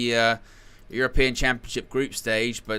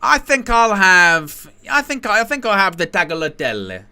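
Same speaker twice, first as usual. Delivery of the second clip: put-on slipped-in Italian accent; 8 semitones higher, 6 dB louder, slower than usual. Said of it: "you just sort of put on the accent".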